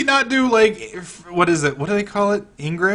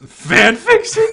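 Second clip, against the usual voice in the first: higher, louder, slow laughing disbelief; laughing shout; 13 semitones higher, 9 dB louder, much slower than usual. Real, not acted. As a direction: shout it out through a laugh in shock. Reacting to fan fiction joke.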